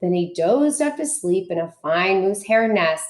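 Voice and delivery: ordinary delivery, nothing marked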